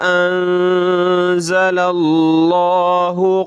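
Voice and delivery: slow, drawn-out tilawat; Quran recitation. Shown as a demonstration. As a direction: recite the verse slowly with melody. Recites the full verse. (धीमी सुरीली तिलावत)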